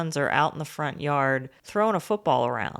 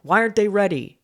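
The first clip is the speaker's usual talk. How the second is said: raised, higher-pitched irritated demand; mock angry dad; 5 semitones higher, 6 dB louder, faster than usual. Dad confronts his ex at her door, mock angry. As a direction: imitate a furious father demanding answers, louder. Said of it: "he's so mad".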